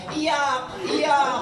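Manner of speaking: lightly voiced baby crying words, laughing; mock baby cry. Pastor imitating a newborn for the congregation, mock cry. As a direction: play a baby wailing the word, amused. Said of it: "sabi ng bata".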